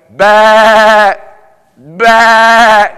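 loud bleating "bah, bah" called out across a hill; shepherd's sheep call. Retold or imitated. Preacher imitating his call to grazing sheep, loud bleat. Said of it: "I thought I could call the sheep, and all of a sudden I went".

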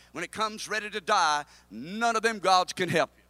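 ordinary talk

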